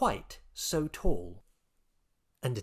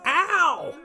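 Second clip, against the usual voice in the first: very high, loud shout of pain; pained yell; 8 dB louder than usual. Acted. President yelling as his tail is squashed, loud pained cry. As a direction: yelp as your tail is trodden on. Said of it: "yelled President Al Allosaurus".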